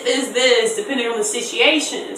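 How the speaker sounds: ordinary delivery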